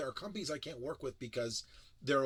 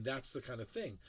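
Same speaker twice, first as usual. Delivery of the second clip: usual speaking voice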